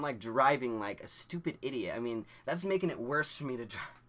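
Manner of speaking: ordinary delivery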